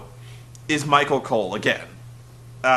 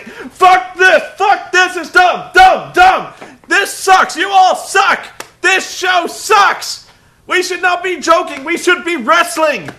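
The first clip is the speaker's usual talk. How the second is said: high, loud ranting shouts; furious rant; 13 semitones higher, 9 dB louder, slower than usual. Retold or imitated. Cole banging a gong and raging at the show, hammed-up shouting. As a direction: blow up like a commentator who has had enough. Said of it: "he starts hitting a gong".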